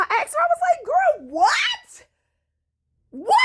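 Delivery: high, loud disbelieving cry; shocked cry. Retold or imitated. Retelling asking the friend in loud shock. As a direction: screech the question in shock.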